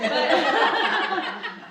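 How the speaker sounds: a big real laugh, high and loud; loud burst of laughter. Real, not acted.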